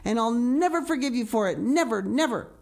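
put-on wounded child's furious rant; vehement. A narrator's reading. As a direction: pour out the hurt and anger vehemently. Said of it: "she repeated vehemently".

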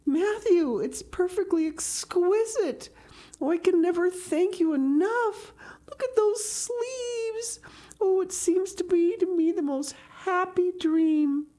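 put-on very high, gushing girl voice; swooning young girl delight; ecstatic Anne. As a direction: pour out thrilled thanks, stretching the key words. Voices Anne thanking Matthew.

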